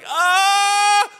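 long high yell from laughing too hard; loud, sustained, high "aah". Retold or imitated. Man overcome by laughter on the floor, acted yell. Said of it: "I'm yelling".

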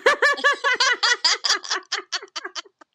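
loud squealing laugh; high laughter. Real, not acted. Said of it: "That's perfect".

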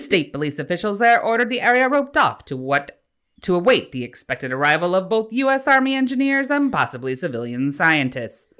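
put-on loud old-time newsreader accent; exaggerated transatlantic announcer voice; mid-Atlantic accent. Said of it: "you're trying to do, like, a mid-Atlantic accent?".

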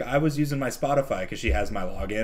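normal talking voice

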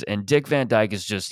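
usual speaking voice

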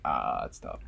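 very low, mumbled mock [name]. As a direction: drop way down and mumble it. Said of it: "And [name] was like".

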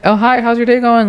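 high, chirpy small talk; mock friendly greeting. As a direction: sweeten it up like a fake friend.

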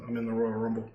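low, quiet mumbled line; murmur. Retold or imitated. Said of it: "And he goes".

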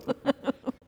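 sudden high burst of laughter. Real, not acted. Husband laughs at joke.

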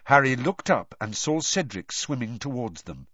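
plain reading voice

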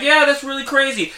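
high offhand astronaut line; casual astronaut. Retold or imitated. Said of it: "he's like".

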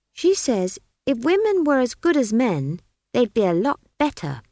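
pert, high-pitched retort; defiant child. A narrator's reading. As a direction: argue back like a stubborn child. Child Esk argues.

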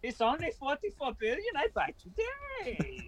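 high mock-foreign Elon voice; squeaky rising taunt, stretched end. Mocking Elon buying Twitter.

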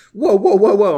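loud, high string of startled protests; mock-panicked pleading to calm down; alarmed. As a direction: re-enact a startled mate backing off. Acting out the scared friend's reaction.

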